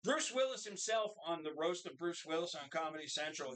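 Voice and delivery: ordinary delivery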